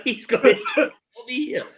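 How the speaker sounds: high, laughing dread; squeaky-high and cracking up; laughing mock panic. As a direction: retell your panic through helpless laughter. Retelling his panic, laughing.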